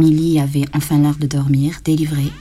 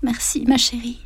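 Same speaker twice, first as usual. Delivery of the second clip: higher and softer, a dying woman's thanks; frail tender whisper; 6 semitones higher, 5 dB quieter than usual. A narrator's reading. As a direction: murmur it tenderly, barely there. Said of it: "chuchota Émilienne". (remerciement tendre et faible)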